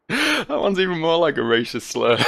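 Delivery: amused, laughing through it; laughing while speaking. Real, not acted. Player laughs at the name Chinggis, laughing.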